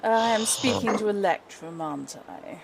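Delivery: silky, sinister villain greeting; evil villain voice. Acted. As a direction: purr it like a scheming villain. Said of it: "my evil villain voice".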